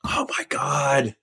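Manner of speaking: whispered in awe. Real, not acted. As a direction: breathe it out in realisation. Realising the missed chance.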